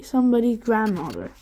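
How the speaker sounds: plain reading voice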